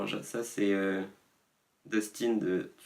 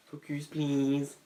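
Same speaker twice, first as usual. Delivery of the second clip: drawn-out mock command; 5 semitones higher, much slower than usual. Mock camera focus command.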